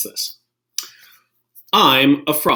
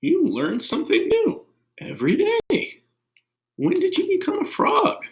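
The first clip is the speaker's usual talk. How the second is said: very high, wondering Gerald voice; high-pitched astonished elephant; amazed Gerald. Acted. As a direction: squeak it out in amazement.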